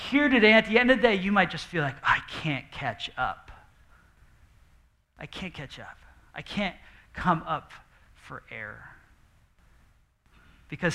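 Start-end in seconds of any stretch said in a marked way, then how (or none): 2.0-8.9 slow, soft, drained murmur; weary inner voice. Retold or imitated. Pastor voicing an overwhelmed heart, slow and hushed. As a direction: sound like you're going under.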